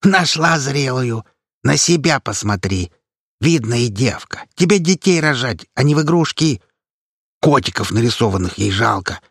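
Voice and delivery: lightly voiced old granny tearing into the girl; a hint of a cranky old granny; granny scolding. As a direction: scoff at the young woman.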